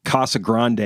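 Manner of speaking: Casa Grande as KAH-sa GRAHN-day; out-of-towner pronunciation. Shown as a demonstration. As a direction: open AH vowels in Casa Grande, ending in -day. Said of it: "people from out of town".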